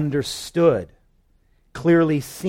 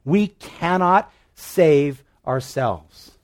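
preaching; 3 semitones higher, 6 dB louder than usual. Acted. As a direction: hammer each line home from the pulpit. Preaching climax.

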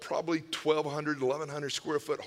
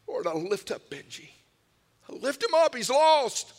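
high, loud, re-enacted intercession; pleading prayer; 7 semitones higher, 5 dB louder than usual. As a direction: lift the voice high and plead with God. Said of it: "I remember praying for him".

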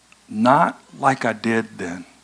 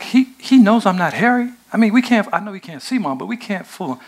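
high, anxious pleading to Mom; fretting that the plan will fail; worried protest; 8 semitones higher, faster than usual. Retold or imitated. Jacob pleading with his mother, anxious and high. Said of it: "Jacob's like".

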